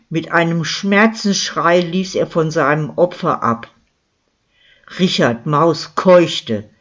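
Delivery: plain reading voice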